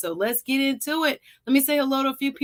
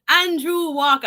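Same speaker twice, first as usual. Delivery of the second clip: higher, louder Jamaican lilt; Jamaican accent; 7 semitones higher, 5 dB louder than usual. Real, not acted. Host greeting Andrew Walker once more, Jamaican accent. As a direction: call the name out in a Jamaican accent. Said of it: "y'all think I'm Jamaican".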